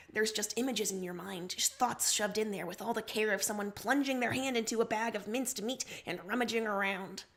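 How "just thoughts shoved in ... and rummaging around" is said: ordinary delivery, nothing marked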